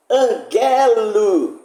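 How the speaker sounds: anGElo with a long, drawn-out GE; angelo, accent moved onto GE; shifted syllable stress. Shown as a demonstration. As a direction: stretch the middle syllable of angelo very slowly. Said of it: "quando se altera a sílaba tônica".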